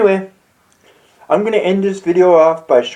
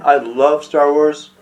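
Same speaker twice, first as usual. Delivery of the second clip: ordinary talk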